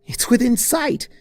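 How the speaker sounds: high, bright cry of relief; relieved exclamation. A narrator's reading. Twilight spotting Fluttershy's cottage, relieved exclamation. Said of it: "she exclaimed in relief".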